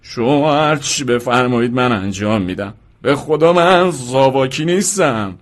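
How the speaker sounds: drawn-out, whining promise; tearful pleading. A narrator's reading. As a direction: swear innocence in a rising wail. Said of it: "مرد اشکهایش را پاک کرد". (التماسِ کشیده و گریان)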